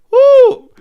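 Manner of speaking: sudden high, loud woo; overwhelmed whoop. Real, not acted. Viewer reacting to the film title, loud high woo.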